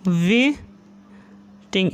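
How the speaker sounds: Waiting drawn out very slowly, each syllable held; slowly stretched dictation. Shown as a demonstration. Teacher dictating waiting to shorthand students, very slowly stretched.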